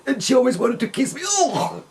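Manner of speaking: high, fast, disgusted quote of the father; a touch of revolted disgust, imitating father. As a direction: mimic him recoiling in disgust. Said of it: "shaking over his whole body of disgust".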